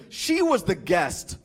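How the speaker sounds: slow, dead-earnest delivery. Retold or imitated. Friend explaining himself after the meal, dead earnest. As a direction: say it slowly, in complete seriousness. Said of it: "with dead earnestness".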